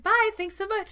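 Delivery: high, quick-sign-off customer service voice; chirpy pickup worker. Retold or imitated. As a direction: imitate a worker's high-pitched goodbye. Voicing grocery pickup exchange, high.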